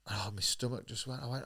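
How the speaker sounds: usual speaking voice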